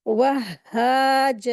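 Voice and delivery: "वहाजा" very slow, "wah-haa-jaa", doubled h and long vowels; correct shadda. Shown as a demonstration. Teacher correcting the common mistake, slow doubled-h recitation. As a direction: stretch "वहाजा", pausing on the first h. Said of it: "ये अलिफ मद्दा हो जाएगी".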